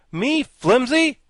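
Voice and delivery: high slow furious Me flimsy; enraged. A narrator's reading. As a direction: explode in outrage at the insult. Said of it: "cried Jack in a rage".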